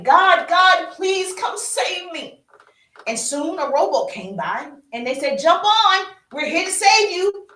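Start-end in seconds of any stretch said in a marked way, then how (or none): 0.0-2.5 high, pleading call to God; desperate prayer. Acted. A man in a flood praying to be saved, desperate. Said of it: "he was praying". 5.4-7.5 high, loud rescuer's shout; calling out. Acted. Rowboat rescuers to the man in the flood, calling out.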